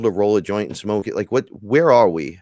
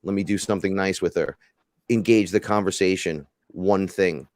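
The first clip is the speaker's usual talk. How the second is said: ordinary delivery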